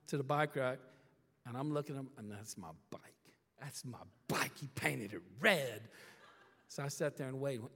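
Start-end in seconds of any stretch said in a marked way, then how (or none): 2.2-3.0 much softer, lower, disbelieving; stunned hush. Retold or imitated. Spotting his stolen bike. 3.6-5.8 whispered "That's my bike." with no voice. Retold or imitated. Spotting his stolen bike.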